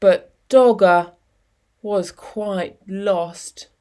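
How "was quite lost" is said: usual speaking voice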